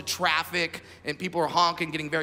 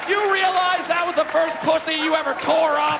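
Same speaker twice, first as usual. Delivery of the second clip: high, raised-voice outburst; acted indignant father yelling; dad's loud complaint; 6 semitones higher, 4 dB louder than usual. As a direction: complain at full pitch, like an aggrieved dad.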